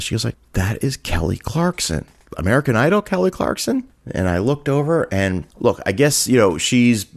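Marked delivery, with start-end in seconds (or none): 0.5-3.8 giddy excitement. Retold or imitated. Hostess reveals the celebrity diner to server, giddy. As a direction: spell out the name, then pitch it way up, lightly giddy. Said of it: "very excited and very giddy".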